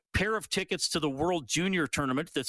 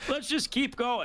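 miner voice; 11 semitones higher than usual. Retold or imitated. Voicing gold rush people.

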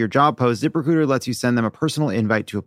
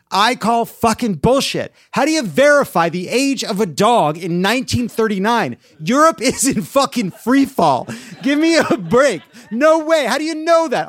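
ranting; 7 semitones higher, 3 dB louder than usual. Real, not acted. Ranting about dog age record.

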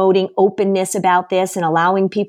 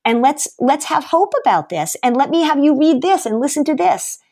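upbeat encouraging; 7 semitones higher than usual. Retold or imitated. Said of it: "I really am trying to say".

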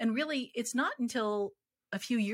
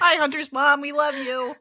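excited greeting; 8 semitones higher, 10 dB louder than usual. Acted. Cheerful greeting to host's mom.

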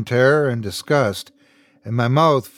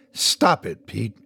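angry growl; 2 semitones lower, slower than usual. A narrator's reading. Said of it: "Her angry voice growled through gritted teeth".

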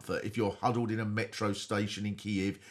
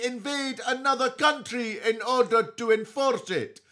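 George Galloway impression; 11 semitones higher, 4 dB louder than usual. Pundit George lectures Ukraine on Putin's virtues, pompous Galloway voice. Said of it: "have George with us this week".

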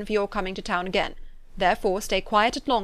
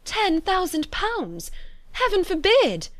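aghast exclamation; 10 semitones higher, slower than usual. A narrator's reading. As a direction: exclaim in horrified disbelief. Aghast at the huge sum.

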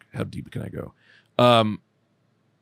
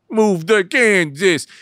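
louder slow command; 10 semitones higher, 7 dB louder, much slower than usual. Pretend. Repeated louder, slower.

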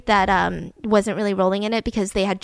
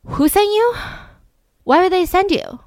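high, louder incredulous question; mock bafflement; 9 semitones higher, 4 dB louder than usual. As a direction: question it as the baffled officer, hammed-up. Police puzzled at being sent.

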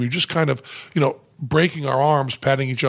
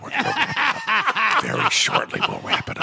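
high squeak into whisper; giggly prank whisper; 14 semitones higher than usual. Pretend. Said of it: "if I whisper into the mic".

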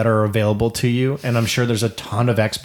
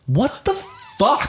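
much higher, slow, drawn-out mock outrage; stunned disbelief; 12 semitones higher, much slower than usual. Dad retelling how he muttered to himself walking away, in stunned disbelief. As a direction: let it stretch out in disbelief. Dad's retold reaction to daughter.

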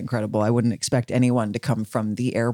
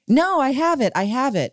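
mock stubborn protest, much higher; stubborn denial; 12 semitones higher than usual. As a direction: insist you've got it, hammed up. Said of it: "everyone is like".

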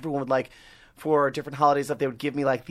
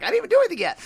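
exasperated high protest; 5 dB louder, much faster than usual. Retold or imitated. Retelling own protest to mom.

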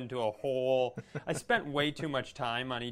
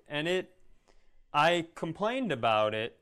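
ordinary talk, nothing marked

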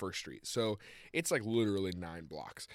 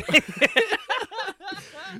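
loud, high laughing burst; high, helpless laugh; real laughter; 10 dB louder than usual. Real, not acted. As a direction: crack up mid-story. Cracking up before resuming.

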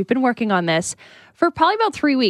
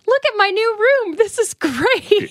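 put-on excited little girl voice; 9 semitones higher, slower than usual. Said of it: "being like".

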